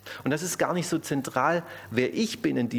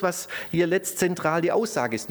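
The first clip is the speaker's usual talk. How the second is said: ordinary talk, nothing marked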